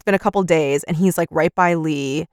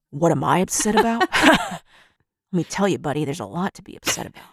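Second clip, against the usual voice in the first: a hint of a gloomy brother; 3 semitones lower, 5 dB quieter than usual.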